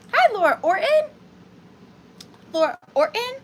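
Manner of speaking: high, loud sing-song "Laura Orton"; greeting a viewer with an odd high, loud lilt. Real, not acted. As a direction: call the name out in a high, bouncy lilt. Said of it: "I don't know why I said it like that".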